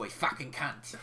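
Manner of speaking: low-key mock Cockney; Cockney accent. Shown as a demonstration. Podcaster demonstrating how the swear is allowed, mock Cockney accent. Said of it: "with the Cockney accent".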